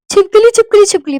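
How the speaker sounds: high, loud cry of alarm; retold scream. Hostel girl screaming for help about a lizard, retold scream. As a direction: shriek it out in repeated bursts. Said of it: "मैं चिल्लाई".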